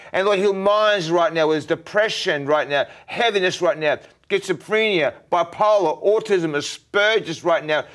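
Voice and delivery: high, loud commanding prayer; fervent healing prayer. Real, not acted. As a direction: pray with full force, commanding each ailment to leave.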